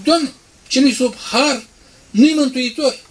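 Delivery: loud, slow, high pleading to the Lord; fervent mock prayer. Preacher voicing a believer, loud and slow. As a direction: cry it out slowly to God.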